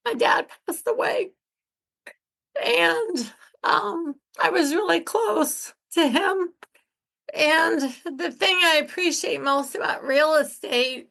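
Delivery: high, cracking voice through tears; crying. Real, not acted. Telling listeners her dad passed away, crying. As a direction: push words out while crying. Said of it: "when I start crying, my voice gets really high and cracks bad".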